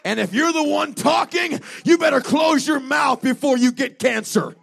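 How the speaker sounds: driving, shouted revival sermon; urgent preacher in full cry; full-force preaching. Real, not acted. Preaches at full force, voicing two inner voices.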